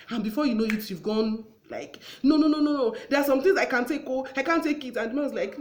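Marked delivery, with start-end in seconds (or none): 2.2-5.0 loud, high acted outburst of refusal; raised voice. Wife refusing her husband in an argument, voice raised. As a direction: protest heatedly, voice raised high. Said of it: "maybe you now raise your voice".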